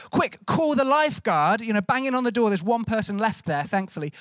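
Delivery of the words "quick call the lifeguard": higher-pitched urgent call for help, retold; retold cry to fetch the lifeguard, drawn out. Man at the pier raising the alarm at the cafe, urgent call. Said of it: "we say".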